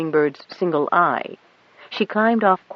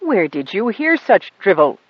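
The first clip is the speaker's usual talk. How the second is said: a touch of disgust, a bit louder; 4 semitones higher, 4 dB louder than usual. A narrator's reading. The pilot scoffs at what the girl was taught, disgusted. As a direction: scoff at the nonsense. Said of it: "in a disgusted tone of voice".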